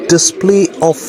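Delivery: high put-on British voice ordering him out; fake UK accent. Son orders man thrown out.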